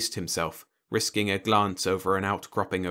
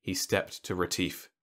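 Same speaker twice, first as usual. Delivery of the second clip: plain reading voice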